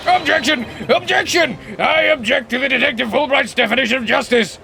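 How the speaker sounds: high, loud courtroom objection; shouted objection. Acted. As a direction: fling the objection across the courtroom. Voicing the defense attorney character.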